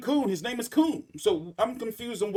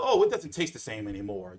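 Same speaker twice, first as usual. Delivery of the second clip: much lower, whiny mock shopper; mock customer complaint; 10 semitones lower than usual. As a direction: parody a picky customer. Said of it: "people that are gonna even say".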